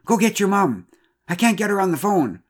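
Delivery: high, loud urgent order; shouted. A narrator's reading. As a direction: call out in a hurry for help. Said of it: "she shouted".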